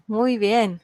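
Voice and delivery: ordinary talk, nothing marked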